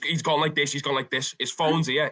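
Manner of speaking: ordinary talk, nothing marked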